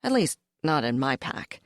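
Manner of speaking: ordinary delivery, nothing marked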